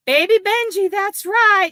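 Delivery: high, loud cooing at the dog; pet talk. Real, not acted. Owner coos at her dog Benji, high and loud.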